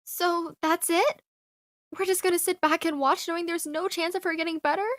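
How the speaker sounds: very soft, stunned questions; hushed disbelief. Acted. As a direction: ask it quietly, barely believing it. Hears mom gets the placebo.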